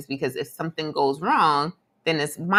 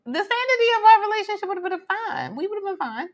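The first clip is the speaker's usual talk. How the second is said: much higher, indignant objection; high-pitched protest; 13 semitones higher than usual. Real, not acted. Disputing his claim about the relationship, high-pitched protest. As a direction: push back in a raised, high voice. Argues back against his justification.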